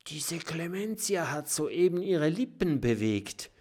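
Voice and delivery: high, hushed porcupine voice; scared porcupine voice; frightened whisper. A narrator's reading. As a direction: whisper it, terrified. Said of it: "flüstert Mathilda".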